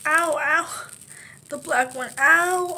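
real pain while peeling skin; sharp ouch as the mask pulls. Real, not acted. Peeling a mask off her face.

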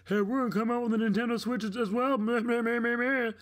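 high, fast mocking singsong; a childish mock of the company, then bleating meh; mocking Pokemon. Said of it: "you hear the rage in my voice".

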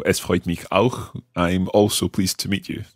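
usual speaking voice

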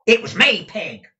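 high, very loud reply; shouting to be understood. A narrator's reading. Gride making himself clear to deaf Peg, shouting. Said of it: "to render the reply more intelligible".

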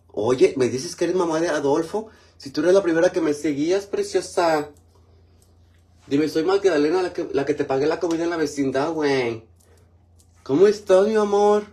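high and loud, bubbly chatter; gushing. Real, not acted. Bubbly chatter to a loyal follower reading her comment.

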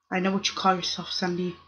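normal talking voice

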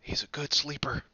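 ordinary delivery

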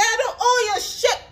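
high, loud defiant retort; loud, high clapback; defiant shout. Retold or imitated. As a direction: fling it back at her, hard. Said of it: "she said".

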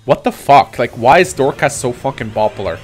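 normal talking voice

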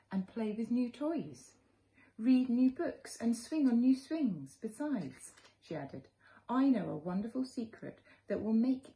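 put-on gentle read-aloud for little children; storybook narration. A narrator's reading. Teacher reading a picture book to children, gently. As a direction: tell it like a bedtime story to kids.

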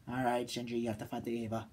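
low, soft, serious order; resigned instruction. Retold or imitated. Misato telling Shinji he must fight, low and grave.

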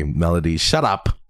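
normal talking voice

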